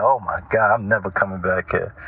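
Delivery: low-pitched imitation of a put-off visitor; mock private vow, pitched well down; appalled guest aside. Voicing appalled guests' private reaction.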